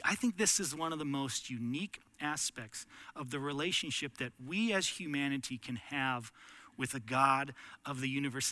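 usual speaking voice

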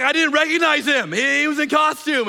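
high, loud, flustered retelling of the admin's panic; mock-panicked church admin caught out. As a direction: blurt it out in a panic, half laughing. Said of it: "So she starts panicking".

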